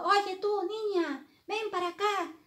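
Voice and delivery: high-pitched imperious storybook queen; angry shouting queen. Acted. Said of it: "muy, muy enfadada, gritando".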